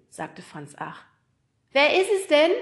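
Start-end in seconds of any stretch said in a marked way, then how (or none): 1.7-2.5 mother's high, loud call from another room. A narrator's reading. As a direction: call loudly through the house. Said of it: "rief die Stimme von Nemos Mutter".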